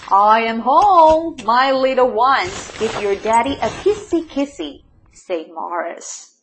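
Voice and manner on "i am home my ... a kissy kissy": loud homecoming call, long first words. A narrator's reading. Mole to his little ones, calling out.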